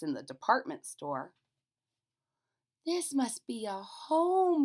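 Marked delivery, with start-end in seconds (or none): 2.8-4.6 high, slow awed little bear; wondering teddy bear. A narrator's reading. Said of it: "he said".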